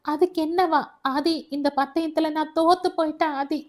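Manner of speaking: high, acted distressed outburst; tearful. A narrator's reading. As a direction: confess defeat as if crying. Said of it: "குலுங்கி அழ ஆரம்பித்தாள்". (அழுதபடி ஆதங்கமாக)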